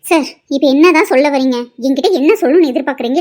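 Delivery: loud, fed-up demand for an answer; exasperated outburst. Acted. Employee demanding her pushy boss finally speak plainly, loud and fed up.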